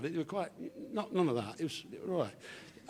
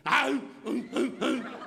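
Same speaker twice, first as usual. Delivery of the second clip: high, loud repeated ow; comic high-pitched yelps; yelping; 9 semitones higher, 4 dB louder, slower than usual. Acted. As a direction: yelp out a string of sharp ows. Acting out husband's nightly bother.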